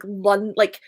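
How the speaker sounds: usual speaking voice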